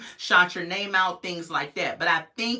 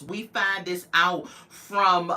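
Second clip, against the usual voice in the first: ordinary talk, nothing marked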